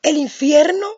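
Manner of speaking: high, loud frightened cry to the Lord; frightened plea. Retold or imitated. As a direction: beg in terror, voice leaping up. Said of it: "Yo dije". (súplica aterrada, aguda y fuerte)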